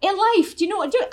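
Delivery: high, loud Irish voice; excited Irish accent. Retold or imitated. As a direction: press the question in an Irish lilt. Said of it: "Can I make her Irish?".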